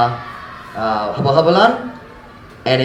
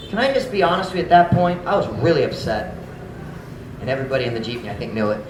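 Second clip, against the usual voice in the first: ordinary talk, nothing marked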